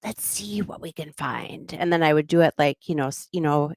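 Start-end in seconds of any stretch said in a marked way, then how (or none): 0.0-1.7 slow, soft Australian whisper; hushed Australian nature-show voice; whispered Australian host. Retold or imitated. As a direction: whisper it like a host stalking an animal. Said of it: "So I tried to, like, do that".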